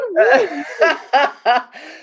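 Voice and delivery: high laughter that won't stop. Real, not acted. Host still laughing after the crazy ex-girlfriend joke. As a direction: giggle fit.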